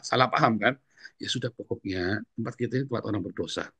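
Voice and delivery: ordinary talk, nothing marked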